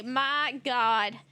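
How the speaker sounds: ordinary delivery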